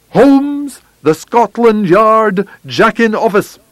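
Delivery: very high, indignant outburst; escalating angry taunt. A narrator's reading. Visitor escalating insults at Holmes, shrilly high and scornful. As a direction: pitch the insult right up high.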